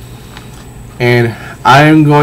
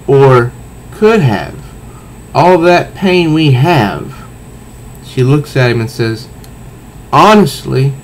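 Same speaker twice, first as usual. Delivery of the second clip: dramatic comic reading; 3 semitones higher, slower than usual. Acted. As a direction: build to a weary shrug. Selina's final line, tears.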